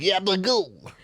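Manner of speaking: gabagool in a light mock Italian accent; lightly voiced Italian accent. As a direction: echo it as a cartoon Italian. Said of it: "Italian accent".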